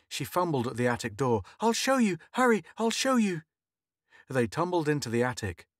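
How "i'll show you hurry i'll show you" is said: high, urgent pleading; frantic. A narrator's reading. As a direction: hurry him along in panic. Frantic wife pulls husband.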